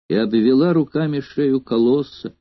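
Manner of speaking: usual speaking voice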